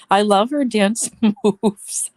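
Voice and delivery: giggling through the words; laughing fondly at a friend's dance moves. Real, not acted. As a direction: laugh while you say it. Laughing about a friend's dancing.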